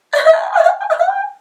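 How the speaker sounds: put-on high squealing baby dragon cry; a little dragon wailing. A narrator's reading. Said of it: "cried the baby dragon".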